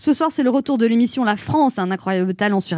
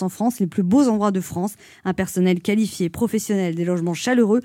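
sensual voice; 4 semitones lower than usual. Acted. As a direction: purr the ad copy seductively. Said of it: "je prends une voix sensuelle".

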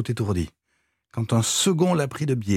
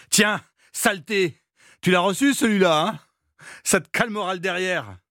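high, jeering shouts between blows; shouted insults; 7 semitones higher than usual. A narrator's reading. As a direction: hurl the taunts like buckets of water. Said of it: "accompagné d'un éclat de voix". (insultes criées et moqueuses, voix haute)

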